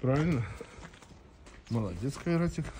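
usual speaking voice

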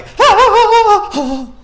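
loud, high, wavering cry of fright; frightened shriek. Real, not acted. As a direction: let out a long high scared cry. Final jump scare.

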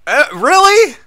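high, loud cry of disbelief; loud high-pitched exasperation; exasperated yell. Real, not acted. Exasperated at game.

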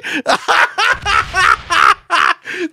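high, delighted laughing. Real, not acted. Streamer laughing at the quit, high delighted laugh.

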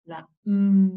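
"La M" with M as a long loud hum; letter sound. Shown as a demonstration. Teacher naming the letter for children, hummed "M". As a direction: say "La", then hold "M" as mmm. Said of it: "a presentarnos una letra".

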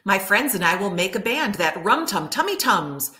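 plain reading voice

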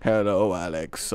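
low, slow narrator impression; Morgan Freeman. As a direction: drop back into a low, slow celebrity voice. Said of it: "I can't do it anymore".

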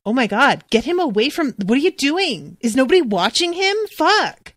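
horrified bystander watching a disaster; panicked onlooker. Pretend. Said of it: "somebody's going".

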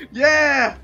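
excited yell. Real, not acted. Gamer cheering getting inside.

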